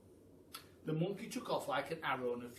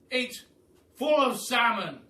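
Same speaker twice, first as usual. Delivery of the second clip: loud, grand aristocratic Duke, higher; booming Duke; 9 semitones higher, 14 dB louder, slower than usual. Acted. The Duke welcomes the hungry giraffe, booming and grand. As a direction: boom it out like a jolly grand duke.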